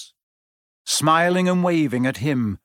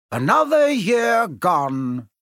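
high, drawn-out cheery announcement; cheerful; 6 semitones higher, 4 dB louder, much slower than usual. A narrator's reading. Headmaster opening the end-of-year feast, cheerfully. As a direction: sing out the words slowly to the hall. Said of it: "Dumbledore said cheerfully".

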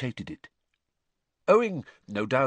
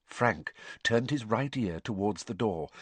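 plain reading voice

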